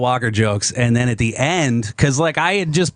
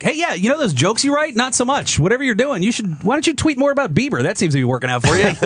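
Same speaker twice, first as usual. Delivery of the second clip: much higher, chirpy mock corporate voice, speeding up at the end; mock Twitter voice; 9 semitones higher than usual. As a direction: play Twitter as a sarcastic helpful app, pitched way up. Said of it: "so Twitter was basically like".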